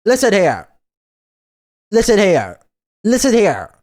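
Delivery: hammed-up scolding; loud mock scold; mock stern.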